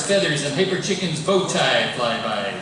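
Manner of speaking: ordinary talk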